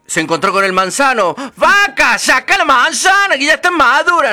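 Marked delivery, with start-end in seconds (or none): 1.6-4.3 high, loud calling voice; apple tree's cry. Acted. Apple tree begs the lazy girl to pick apples, high and loud. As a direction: call out to her urgently.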